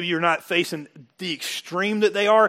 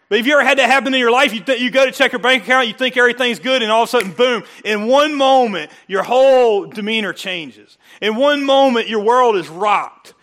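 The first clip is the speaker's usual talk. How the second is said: higher and louder, pounding "one moment"; preaching at full force; 5 semitones higher, 6 dB louder than usual. Acted. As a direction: drive the congregation home with rising intensity. Preaching build-up.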